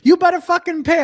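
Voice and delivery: high-pitched, indignant cursing rant; mock angry scammer. Imitating a scammer who got mad over payment, mock angry. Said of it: "they get mad at you".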